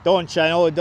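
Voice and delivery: normal talking voice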